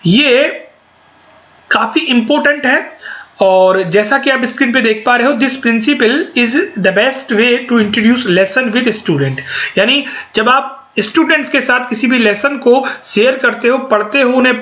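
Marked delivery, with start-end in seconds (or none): 5.3-9.4 slow, careful slide reading. Shown as a demonstration. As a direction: spell it out slowly for notes. Reads slide text aloud. (धीमी गति से slide पढ़ना)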